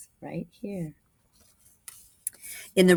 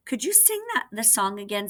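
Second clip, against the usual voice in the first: lifted high, gently asking; a puppet king's high request; 6 semitones higher than usual. Acted. King asking the girl puppet, high and light.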